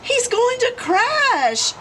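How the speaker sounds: very high, slow, loud yell; alarmed yell. A narrator's reading. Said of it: "yelled Ozzie".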